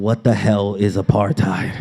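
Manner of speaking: loud, emphatic mock bafflement; baffled outburst. Reader stumped by the book's first word, baffled. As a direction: blurt it out loudly, utterly stumped.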